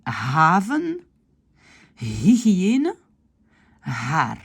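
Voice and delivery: haven, hygiëne, haar each with a breathed h; separate, stretched words with clearly aspirated h; audible h. Shown as a demonstration. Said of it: "on dit le H".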